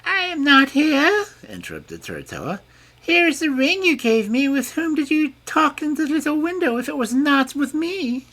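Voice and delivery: a false bride insisting; insistent princess. A narrator's reading. Princess claims the king.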